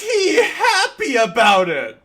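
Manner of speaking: loud, high and slow through laughter; incredulous laughing yell; laughing outcry. Real, not acted. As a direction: shriek the question while cracking up. Laughing outrage at game scene.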